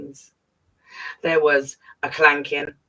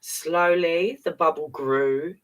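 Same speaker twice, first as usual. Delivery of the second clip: ordinary delivery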